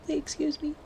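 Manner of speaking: mock indignant. Scolding Fizz to use command.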